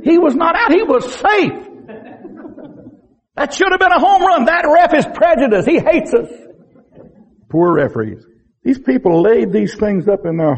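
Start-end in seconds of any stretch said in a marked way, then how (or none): 0.0-6.3 high, hammed-up protest that breaks into laughs; mock infuriated parent. Parent yelling at the referee over a call, mock fury. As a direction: play the outraged Little League parent arguing the call. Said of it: "you're, you're infuriated".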